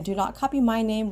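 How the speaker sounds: normal talking voice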